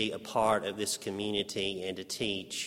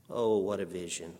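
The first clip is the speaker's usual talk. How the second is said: normal talking voice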